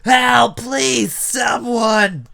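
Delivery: high, loud desperate shout; man screaming for help; screamed plea for help. Acted. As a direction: cry out for rescue at full volume. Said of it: "they heard a man scream".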